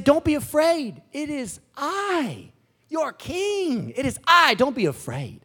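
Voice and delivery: high, ringing proclamation as Jesus; Jesus calling out. Acted. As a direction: announce yourself to frightened followers, drawing out key words. Acting Jesus calling to disciples.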